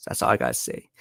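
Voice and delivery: hoarse, barely voiced; losing voice. Real, not acted. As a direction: voice almost gone, nearly whispered. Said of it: "I'm losing my voice right now".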